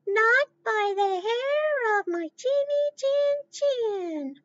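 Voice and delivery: be the tiny pig refusing the wolf; little pig voice. Pretend. Kids answering the wolf as the pig, high and slow. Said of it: "Can you guys say that?".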